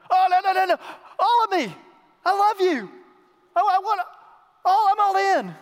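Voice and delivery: high, loud cries of devotion; acted fervent outburst. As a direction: shout it all out as an overeager disciple. Acts Peter's overjoyed total devotion.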